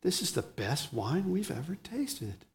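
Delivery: softly voiced, awed wonder; hushed gasping amazement. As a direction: imitate a guest marveling under the breath. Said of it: "everyone gasped and said".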